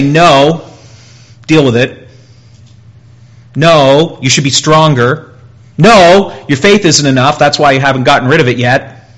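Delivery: loud, scolding voice of a stern accuser; harsh rebuke. Pretend. Preacher mocks harsh Christians telling the suffering no, loud scolding. Mocking what God didn't say.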